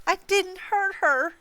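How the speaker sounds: very high, slow wail. A narrator's reading. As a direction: draw out a high, anguished protest. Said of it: "Agatha wailed".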